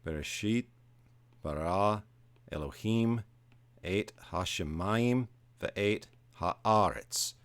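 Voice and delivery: slow, word-by-word scripture reading; measured recitation, each word set apart and lifted; Hebrew verse reading. Shown as a demonstration. Teacher reading Genesis verse one to students in Hebrew, slow recitation. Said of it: "I'm just going to read verse one".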